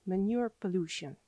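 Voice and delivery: ordinary delivery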